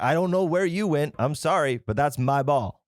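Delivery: acted-out firm claim, slowing at the end; retold insistent apology. Golfer claiming his ball from a stranger, insistent.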